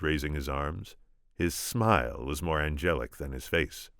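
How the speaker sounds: plain reading voice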